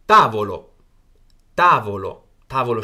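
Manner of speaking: Italian tavolo said slowly and clearly, stress on ta; teacher models tavolo for students, carefully articulated; model pronunciation. Shown as a demonstration. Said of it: "下一个是tavolo".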